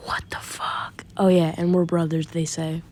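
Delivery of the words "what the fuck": whispered stunned disbelief; shocked whisper. Retold or imitated. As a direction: barely voice it, floored. Zoro shocked at Sabo's powers.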